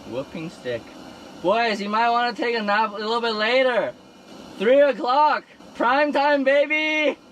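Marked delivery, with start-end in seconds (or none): 1.4-7.2 loud, high, hyped calling out; excited shouting. Real, not acted.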